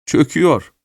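a touch of a cackle in the voice; chuckling. A narrator's reading. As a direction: say it with a mild, high-pitched chuckle. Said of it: "diye kıkırdadı yaşlı Miss Rashleigh".

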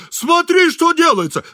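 shrieked alarm, very high and loud; frightened scream. A narrator's reading. Alarmed engineer showing his wife the kneeling stranger, screaming. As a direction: scream it in panic. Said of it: "закричал испуганный инженер".

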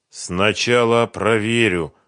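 stubborn. A narrator's reading. Beaten Сухарев lying on asphalt before Фёдор, stubborn. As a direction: draw it out, refusing to yield. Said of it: "упрямо прошептал Сухарев".

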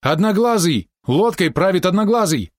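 high, frightened exclamation; alarmed cry. A narrator's reading. Helmsman recognises One-Eye steering the boat, alarmed cry. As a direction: shout the warning in panic.

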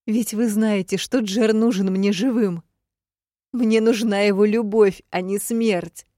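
high, loud, distressed appeal; desperate plea. Acted. Heroine begs the Demiurge for Jer's life, desperately. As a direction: plead with all your force.

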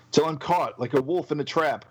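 plain reading voice

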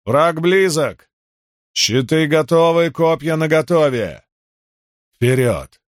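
rally the troops with a shout; battle cry. Acted. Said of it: "сделал глубокий вдох и крикнул".

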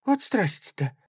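high-pitched awed exclamation; flustered old woman. A narrator's reading. Old woman marvels at the soldier's story, flustered. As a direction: marvel in fright, pitch lifted.

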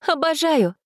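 high, thrilled little exclamation; delighted girl. A narrator's reading. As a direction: gush with delight. Girl's delighted reply.

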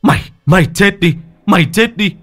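high, loud raging yell; furious shout. Acted. Son pounding the sorcerer, high raging yell. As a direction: roar it with each blow. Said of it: "Văn Tấn quát".

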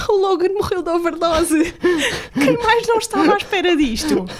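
can't stop laughing while telling it. Real, not acted. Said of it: "Não estamos a rir da overdose".

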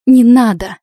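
громкий отчаянный крик; закричи, умоляя его остановиться; отчаянная мольба. A narrator's reading. Героиня умоляет не убивать ведьму. (громко, в отчаянии)

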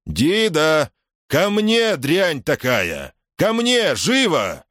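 king yelling high for the dog. A narrator's reading. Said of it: "Голос вдруг стал громче".

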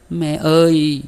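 high, loud tearful cry to mother; sobbing cry. Acted. Minh crying out to her dying mother, high and loud through tears. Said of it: "sụt sịt khóc".

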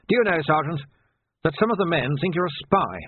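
put-on an old captain carefully testing his man; probing old captain. Old captain asking sergeant about spy rumour, probing.

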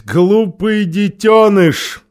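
loud, slow, sneering queen; contemptuous. A narrator's reading. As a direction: sneer it down at the child. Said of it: "презрительно ухмыльнулась".

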